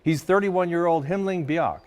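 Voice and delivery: usual speaking voice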